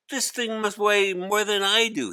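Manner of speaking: put-on a kid groaning under a heavy load; young boy Hari. Hari complaining about backpack.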